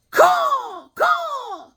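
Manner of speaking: very high, drawn-out bird screech; piercing falsetto bird cry; hawk caw. Pretend. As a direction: screech two long caws like a hawk. Imitates a hawk with the figure.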